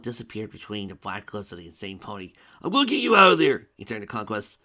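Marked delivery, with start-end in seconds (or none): 2.6-3.7 fast, much louder, high vow; frantic yell. A narrator's reading. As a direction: yell it desperately. Dragon vows to save her.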